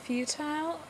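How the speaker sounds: "futile" with a long i, few-tile. Shown as a demonstration. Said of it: "but if I'm saying it in a sentence".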